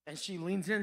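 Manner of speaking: normal talking voice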